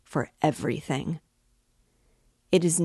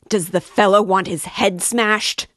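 loud and higher, ready to fight; angry cry; 5 semitones higher, 9 dB louder than usual. Acted. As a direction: bellow it out in outrage. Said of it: "cried Razumihin".

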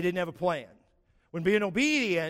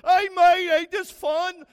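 loud, high Australian cheer; hammed-up Aussie mate, shouting with glee; a cheery Australian yelling across the platform; Australian bloke; 9 dB louder, slower than usual. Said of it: "that one dude from Australia".

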